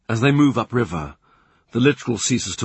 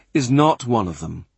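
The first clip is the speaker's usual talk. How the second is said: usual speaking voice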